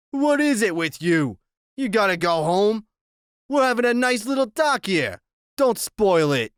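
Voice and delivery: taunting gang boss; menacing biker. Acted. Narrator voices Iggy.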